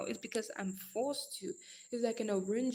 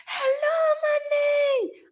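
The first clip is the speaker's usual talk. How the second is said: excited greeting; 13 dB louder, much slower than usual. Real, not acted. Greeting a viewer on livestream.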